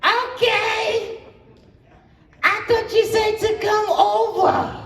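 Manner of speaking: very high squeaky falsetto, desperate woman; shrill over-eager falsetto on the phone. Acted.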